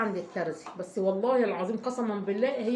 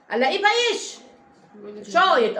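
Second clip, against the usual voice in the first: much higher and louder, exasperated complaint; indignant outburst; 5 semitones higher, 7 dB louder than usual. Real, not acted. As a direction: burst out in disbelief at the ruined cloth. Outraged about the ruined fabric. (استنكار بصوت عالي على القماش البايش)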